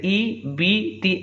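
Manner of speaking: ई बी ती with pure "ee" vowels; maroof reading. Shown as a demonstration. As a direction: model ई बी ती as "ee, bee, tee". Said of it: "यहाँ मारूफ पढ़ना है".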